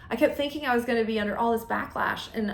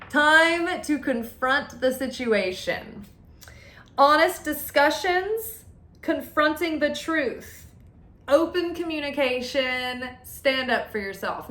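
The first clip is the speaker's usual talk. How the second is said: slow, raised, deliberate delivery; emphatic proclamation; 5 semitones higher, slower than usual. Real, not acted. Card reader announcing the message, slow and emphatic. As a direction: proclaim each phrase, higher and stretched out.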